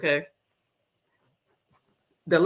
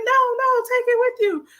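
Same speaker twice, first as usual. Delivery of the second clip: squeaky shop clerk; high mock clerk voice; 4 dB louder than usual. Store staff voiced, high.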